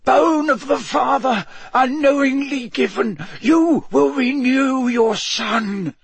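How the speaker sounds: high, terrified incantation; frightened, shaking voice. A narrator's reading. Wormtail speaking a spell to the night, high and frightened. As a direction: chant it high-pitched, frightened beyond your wits. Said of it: "His voice shook. He seemed frightened beyond his wits".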